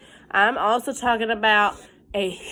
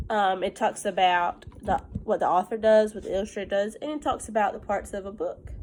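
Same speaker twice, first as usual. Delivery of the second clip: ordinary talk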